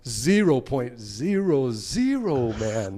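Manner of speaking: slow, stretched-out gleeful mock announcement; Alan crowing. Alan laughing from the back seat, slow crowing. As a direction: draw out each zero, imitating a teasing friend. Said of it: "or is Alan".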